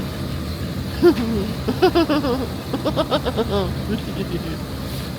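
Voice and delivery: drawn-out self-mocking laugh; long laugh. Real, not acted. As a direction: laugh it off at length.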